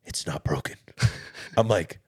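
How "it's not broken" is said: put-on a low whisper, a drunk man insisting; drunk whispered denial.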